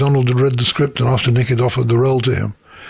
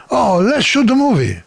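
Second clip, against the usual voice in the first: put-on higher, light comedy Italian accent; breezy mock Italian accent, pitched up; lightly hammed-up Italian accent; 8 semitones higher than usual. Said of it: "do him in a comedy Italian accent".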